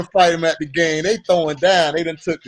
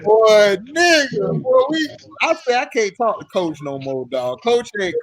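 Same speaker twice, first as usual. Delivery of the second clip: ordinary delivery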